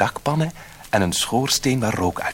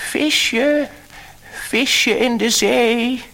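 high, loud slow call across the sea; sing-song loud calling, slow; 5 dB louder, much slower than usual. A narrator's reading. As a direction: call out to the sea, hands cupped round the mouth. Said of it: "zette hij zijn handen voor zijn mond en riep".

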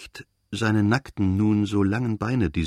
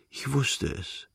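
soft, slow, higher drowsy voice; sleepy child; 4 semitones higher, 6 dB quieter, slower than usual. A narrator's reading. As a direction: say it softly and slowly, half asleep. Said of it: "Verschlafen lächelnd". (verschlafen, leise und langsam)

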